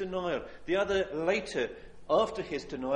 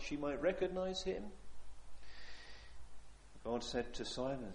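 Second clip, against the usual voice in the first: ordinary delivery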